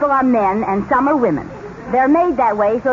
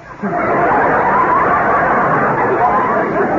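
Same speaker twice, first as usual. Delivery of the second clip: shrieking laugh. Acted. Shocked by boy watching her hips.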